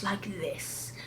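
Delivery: slow, menacing villainess voice; hushed, slow, put-on sinister character voice; Atropos. Shown as a demonstration. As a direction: draw each word out with menace. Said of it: "pull out my Atropos voice".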